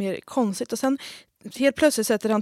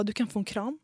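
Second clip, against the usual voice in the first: softer mock offer; 8 dB quieter than usual. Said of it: "han bara".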